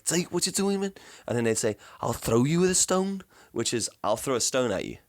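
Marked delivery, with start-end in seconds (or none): 0.0-0.9 higher-pitched attempt at a Cape Coloured accent. Quoting funny schoolmates from South Africa, Cape Coloured accent. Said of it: "I can't do the accent". 2.0-3.2 put-on high, lilting try at a Cape Coloured accent. Quoting a schoolyard saying, imitated Cape Coloured accent. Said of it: "I can't do the accent".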